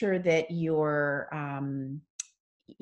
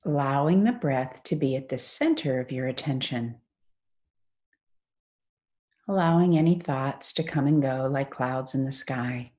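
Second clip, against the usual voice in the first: guided meditation. Acted. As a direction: ease them into stillness, phrase by unhurried phrase.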